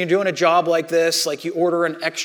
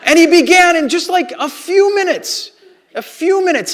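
excited emphasis; 10 semitones higher, 6 dB louder than usual. Real, not acted. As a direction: marvel out loud at how quick it was. Marvelling how fast Doug helped.

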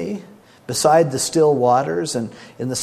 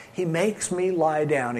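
ordinary delivery

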